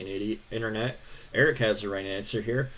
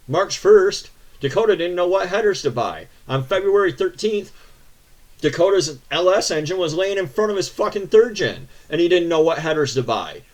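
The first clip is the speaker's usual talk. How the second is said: higher and louder, fuming tirade; angry rant; 6 semitones higher, 7 dB louder than usual. Real, not acted. Ranting to viewers about Dakota's bad advice, raised angry voice. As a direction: lay into him, voice raised the whole way.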